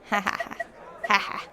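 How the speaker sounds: laughing. Real, not acted. Laughing at wrong-currency joke.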